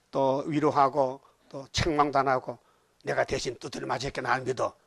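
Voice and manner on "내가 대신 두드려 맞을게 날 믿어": softer, gentle promise; tender reassurance. Acted. Jesus reassuring believers, softly and tenderly. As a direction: soothe like a loving bridegroom.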